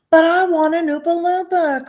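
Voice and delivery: high, loud bratty demand; spoilt cry. A narrator's reading. Spoilt daughter crying out to her father for an Oompa Loompa. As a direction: wail it like a child refused a toy. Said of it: "cried Veruca".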